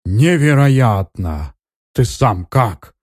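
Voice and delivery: high, loud and slow amazement; amazed. A narrator's reading. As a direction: gasp it out in disbelief. Mage amazed by the healing.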